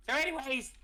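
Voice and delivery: high-pitched tour-guide character voice; high, eager character question; Big Jim. Acted. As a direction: turn briskly back to the visitor. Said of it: "Big Jim's like".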